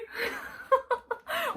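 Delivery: high overwhelmed laugh. Real, not acted. Reacting to spoiler with giddy laugh.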